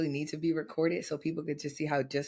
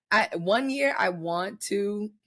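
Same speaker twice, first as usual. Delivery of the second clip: ordinary delivery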